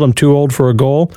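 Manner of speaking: ordinary talk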